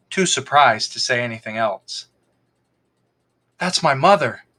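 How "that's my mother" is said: ordinary delivery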